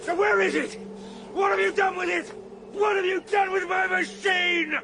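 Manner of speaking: high, loud, crying demands; desperate rage at the Eloi over his machine; frantic furious shouting. Acted. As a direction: scream the questions at them. Traveller rages at the Eloi.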